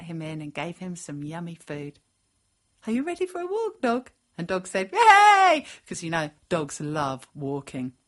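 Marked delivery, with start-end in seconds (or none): 2.9-4.1 high, eager question to a dog; inviting pet talk. A narrator's reading. Girl invites dog for a walk. 4.9-5.6 high, loud cheer; overjoyed dog. A narrator's reading.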